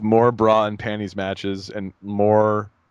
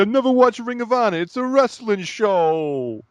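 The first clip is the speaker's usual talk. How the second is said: much higher mock voice, slowing at the end; mocking Russo quote; 11 semitones higher than usual. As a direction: sneer it out as a clueless old booker. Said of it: "he's like".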